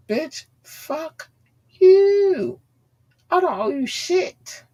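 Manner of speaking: very high, drawn-out angry squeal; high-pitched defiance. Real, not acted. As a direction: stretch the words out high in indignant refusal. Angry rant at an ex-associate.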